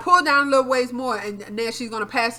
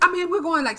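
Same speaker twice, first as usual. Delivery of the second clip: ordinary talk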